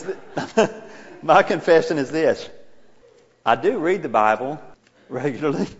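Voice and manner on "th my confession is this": laughing through the words; can't hold back a chuckle; giggling mid-confession. Real, not acted.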